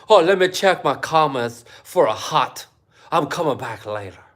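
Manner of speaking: a hammed-up mock voice of a vlogger; a hint of a mocking vlogger voice; mocking impression. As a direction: lightly send up a vlogger checking comments. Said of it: "Do you think that's what he sounds like?".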